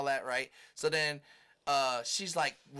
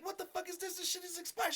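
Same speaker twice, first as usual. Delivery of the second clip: much higher, softer incredulous outburst; retold disbelief; 14 semitones higher, 6 dB quieter than usual. To the driver, he's stunned her insurance expired, high and incredulous. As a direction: squeak it in disbelief. His outrage at expired papers.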